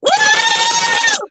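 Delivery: very high celebratory "woo" scream; long high whoop releasing emotion; scream to let it out. Real, not acted. Streamer venting overwhelmed joy at a gift, screamed whoop. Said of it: "I had to get that out".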